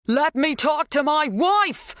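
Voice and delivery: slow, high, strained shout; drawn-out high-pitched yell. A narrator's reading. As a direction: shout a demand through the door. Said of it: "He wasn't used to shouting".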